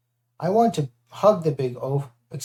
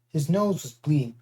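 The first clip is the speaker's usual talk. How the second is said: usual speaking voice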